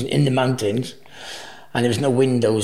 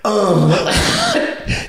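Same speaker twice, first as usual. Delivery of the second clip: mock moan; 10 semitones higher, 5 dB louder than usual. Dave's noise at climax.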